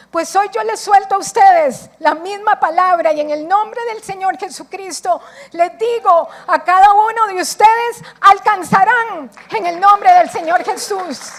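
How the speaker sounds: loud, high proclamation to the congregation; fiery preaching. Acted. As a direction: declare it over them with full force. Preaching at full force.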